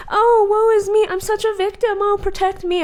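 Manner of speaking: very high, loud, hammed-up whining; mocking people who act helpless, squeaky and over the top; mock victim. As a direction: parody someone playing the poor victim. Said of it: "They're pretending to be like".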